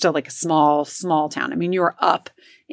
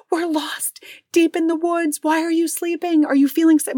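imitating frantic son; 10 semitones higher than usual. Son frantic in lost car.

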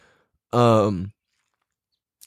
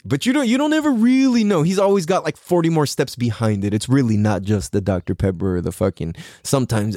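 normal talking voice

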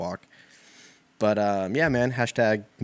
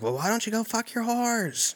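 much higher, put-on voice of a woman shouting into her phone; mock woman yelling; 14 semitones higher than usual. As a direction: play the crazy lady ranting on her cell, pitched way up. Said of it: "Just being like".

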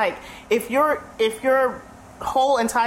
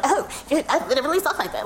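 higher-pitched mock surprise in another's voice; mimicked doubter; 5 semitones higher, faster than usual. Coworker reacting to her proper speech, mockingly mimicked. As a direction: imitate someone amazed at how you talk.

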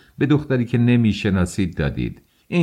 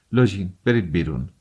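ordinary delivery

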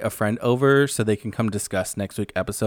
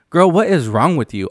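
louder and higher, scolding a TV star; exasperated outburst; 5 semitones higher, 5 dB louder than usual. Real, not acted. Vlogger yelling at Kyle on screen, exasperated.

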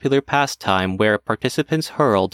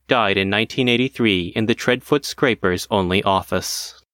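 ordinary delivery

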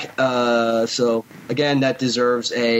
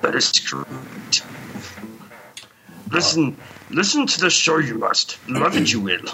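Yoda voice. Pretend. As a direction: speak like the little Jedi master. Yoda-style inverted phrasing.

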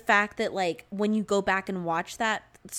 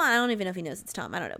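normal talking voice

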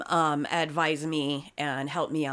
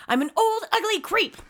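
much higher put-on cartoon witch voice; ugly old creep; 13 semitones higher, 4 dB louder than usual. Joking with her co-host, quoting a cartoon witch in a high voice. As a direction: squeak it out like a Disney villain hag. Sword in the Stone character line.

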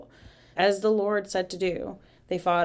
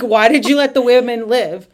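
mock-angry Moses, louder and higher; retold anger; 5 semitones higher, 11 dB louder than usual. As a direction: play at a loud, indignant demand. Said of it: "So Moses is mad".

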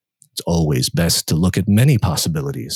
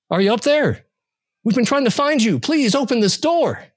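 higher, pleading through the door; urgent worried caller. Acted. Searcher at the door begging him to open, higher and urgent.

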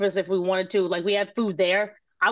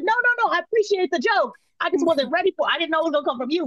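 flustered; 8 semitones higher, faster than usual. Retold or imitated. Retold reply to chef, high.

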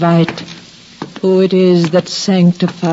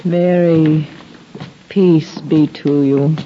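ordinary delivery

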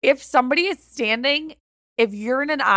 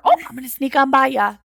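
lightly hammed Midwestern "sneak on by ya"; a friendly Wisconsin accent, played up a little; exaggerated Wisconsin accent; 3 semitones higher, faster than usual. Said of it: "with such a heavy accent".

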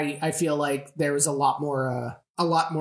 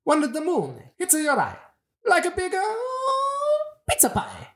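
hammed-up Italian accent, pitched way up; overdone Italian crooner, squeaky and slow. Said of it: "who has the best Italian accent".

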